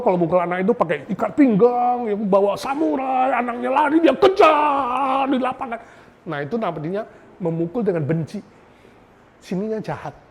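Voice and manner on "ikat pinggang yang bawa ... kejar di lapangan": higher, mounting mock alarm; hammed-up chase story. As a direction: stretch the last phrase like a frantic chase. Acting out angry parent chasing child.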